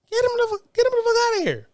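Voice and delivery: ranting about minorities, high and exasperated; exasperated outburst. Real, not acted. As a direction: fling it out, fed up. Repeated demand, much higher.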